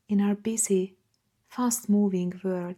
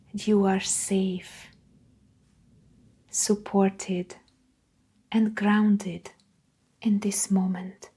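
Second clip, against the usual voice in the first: guided meditation. Acted. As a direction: guide them gently, leaving room after each phrase.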